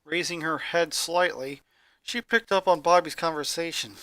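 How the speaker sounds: ordinary delivery, nothing marked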